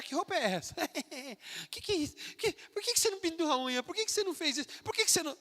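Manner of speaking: high, jeering schoolyard taunt, mildly hammed; a light imitation of mocking teens; mocking classmates. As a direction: sneer at the believer's clothes like a teasing kid. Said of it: "Vão começar a chacotear".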